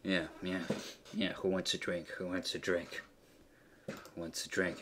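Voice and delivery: low, soft barkeep voice; pug man barkeep. Acted. Pug man behind the bar offering drinks to the room, lightly voiced. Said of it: "a pug man standing there like".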